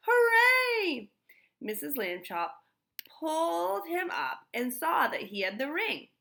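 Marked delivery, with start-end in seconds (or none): 0.1-1.1 high, loud joyful cheer; cried out. A narrator's reading. Stanley in the shaft cheering, high and loud. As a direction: shout with triumph. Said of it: "Stanley cried out".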